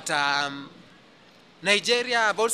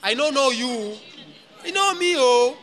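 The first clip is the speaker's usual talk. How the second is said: put-on higher, lively Nigerian Pidgin accent; 7 semitones higher, slower than usual. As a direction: imitate a Nigerian speaker playfully. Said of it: "I love Nigerian accent".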